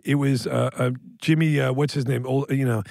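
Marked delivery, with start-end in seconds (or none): none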